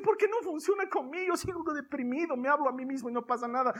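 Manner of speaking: high-pitched mock lament; imitated complaining believer. Voices a discouraged believer complaining.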